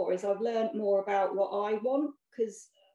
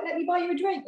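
high-pitched mock American offer; attempted American accent; 10 semitones higher than usual. Runner imitates the stranger offering drinks, high mock American accent. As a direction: imitate an eager American at the bar. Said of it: "I can't do your accent".

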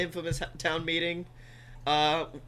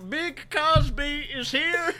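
Cosby voice; 6 semitones higher, 5 dB louder than usual. Pretend. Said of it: "One side says Cosby".

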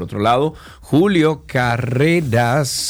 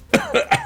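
hard throat clear after choking. Real, not acted. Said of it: "Ay, me ahogué".

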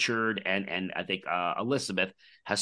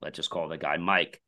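ordinary talk, nothing marked

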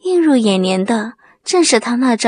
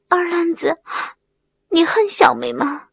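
small, higher voice, hesitant; shy, timid question; 5 semitones higher than usual. A narrator's reading. Wang Mei asks if he hates her, shyly. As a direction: ask it bashfully, pitched up. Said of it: "羞怯地问".

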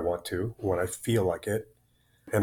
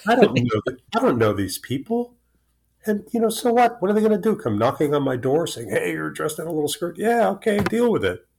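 a defiant, higher-pitched shrug-off of the neighbours; defiant retort; 8 semitones higher, 3 dB louder than usual. Retold or imitated. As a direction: toss it off carelessly, pitched up and louder. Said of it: "And I'm going, like".

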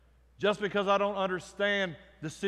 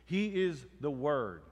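usual speaking voice

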